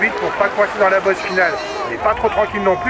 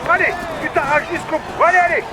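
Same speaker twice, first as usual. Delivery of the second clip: barking gym coach; 10 semitones higher than usual. Acted. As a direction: yell the rider on to the line. Team director yelling rider to finish.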